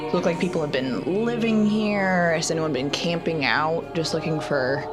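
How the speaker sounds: usual speaking voice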